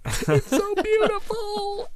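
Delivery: high, slow, wailing mock sob; hammed-up tears of joy over the controller; mock crying. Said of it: "and I'm, like, crying".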